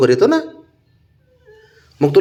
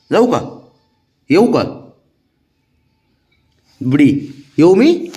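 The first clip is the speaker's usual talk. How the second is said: high, louder teasing ask to a pet; sing-song pleading to a pet; playful pleading; 3 semitones higher, 4 dB louder than usual. Real, not acted. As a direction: ask the dog's leave in fun. Asking pet permission playfully.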